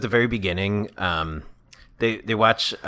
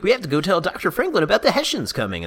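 put-on kids raising alarm; 8 semitones higher than usual. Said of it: "they were like".

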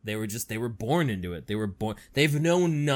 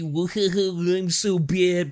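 higher, smug edgy brag; mock edgelord; 5 semitones higher, 4 dB louder, much slower than usual. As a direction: play a cocky edgy teen. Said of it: "It's edgy".

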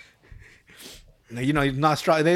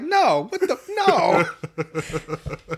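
loud, high exasperated refusal; mock outraged no; 5 semitones higher, 3 dB louder, much slower than usual. Kid asked if the song helped, mock outrage. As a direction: exclaim in disbelief, breaking into laughter.

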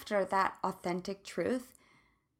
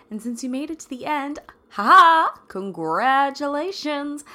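booming, higher game show host voice; 5 semitones higher, 9 dB louder than usual. Pretend. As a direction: announce it like a game show host with a big laugh. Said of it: "That's my best game show host voice".

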